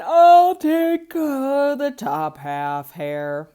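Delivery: big, slow, loud drowsy bear voice; a lazy bear picking the tops; sleepy bear. Acted. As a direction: drag the words out half asleep.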